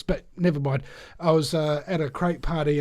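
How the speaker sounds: usual speaking voice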